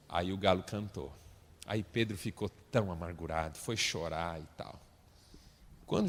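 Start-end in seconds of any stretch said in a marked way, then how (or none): none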